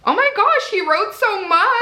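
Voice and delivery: high-pitched gasp of real surprise; overwhelmed by the card, voice jumps up; delighted squeal at a long card; surprised. Real, not acted. Reading a surprise thank-you card.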